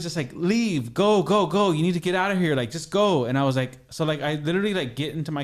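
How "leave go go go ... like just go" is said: mildly raised, higher retold command; pressing, imitated go-now urging; urgent order. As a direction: act out hurrying someone away, higher and insistent.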